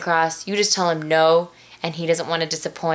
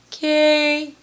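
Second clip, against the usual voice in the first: sad little okay; 9 semitones higher, 3 dB louder than usual. Retold or imitated. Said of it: "You know, all sad".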